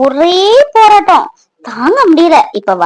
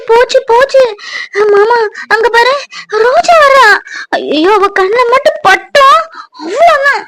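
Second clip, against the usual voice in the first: much higher, near tears, words tumbling out; panicked fear; 7 semitones higher than usual. Acted. Girl panicking as her cousin approaches, high and teary. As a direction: she panics that Roja has seen them. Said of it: "இதுக்கா இப்படி பயப்படுத?".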